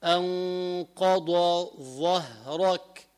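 أنقض ظهرك very slowly, dad and dha kept apart; أنقض ظهرك ببطء شديد; slow careful recitation. Shown as a demonstration. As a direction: stretch أنقض ظهرك to separate the letters. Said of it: "يعني".

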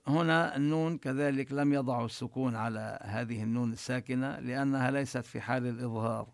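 usual speaking voice

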